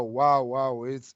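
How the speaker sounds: ordinary delivery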